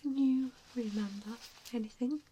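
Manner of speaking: usual speaking voice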